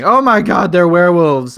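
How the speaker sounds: loud, high-pitched outburst; mock exclamation. Rose to the Doctor about the royal family, a loud mock exclamation. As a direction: blurt it out as a hammed-up revelation. Rose's exclamation acted as episode ends.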